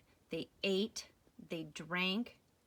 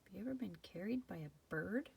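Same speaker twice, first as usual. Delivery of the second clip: whispering; 10 dB quieter, faster than usual. Real, not acted. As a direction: whisper it to the little listener. Asides whispered to child.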